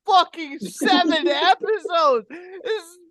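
high, loud, laughing rant. Real, not acted. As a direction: laugh through the words. Laughing rant about episodes.

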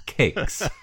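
laughing. Real, not acted. Laughing at a Bilbo line.

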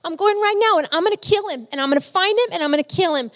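high-pitched, worked-up imitation of a father; retold angry outburst. Retold father's furious argument with wife.

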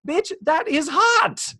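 high pitched burned yelp; shocked yelp. Retold or imitated. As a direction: squeal it, hand just scorched. Joe burned by hot pot.

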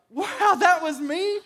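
high, slow, laughing amazement; amazed laughing wow. Retold or imitated. After hanging up the phone, amazed and laughing at yourself. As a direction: marvel at yourself, laughing out loud. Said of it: "and you're like".

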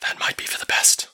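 hushed whisper voice; breathy urgent whisper; whispering Elva. Acted.

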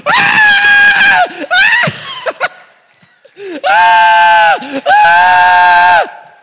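high, loud, repeated screams; roller coaster screams. Retold or imitated. Riders screaming on the Tornado coaster, loud high yells. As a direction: scream as if falling on the drop. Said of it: "The whole roller coaster is screaming".

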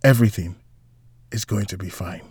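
halting, trailing off very soft and low; fading reassurance. A narrator's reading. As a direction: let the comfort slip away with a pause. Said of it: "felt her grasp on Antonio fade away".

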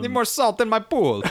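high, loud snooty homeowner; high, loud spoof of a rich guy; mock rich guy voice. Mocking guest in put-on voice.

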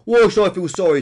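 loud, high retort; defiant. A narrator's reading. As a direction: throw it back at your critic. Peter refuses to be sorry.

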